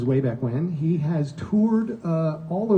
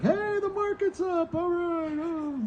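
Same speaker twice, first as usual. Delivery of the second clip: put-on old uncle voice; 12 semitones higher, 5 dB quieter than usual. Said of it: "he's like".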